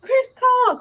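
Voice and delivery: mock the fans swooning over a call; imitating an overjoyed tearful crowd; mocking gush. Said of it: "everybody was like".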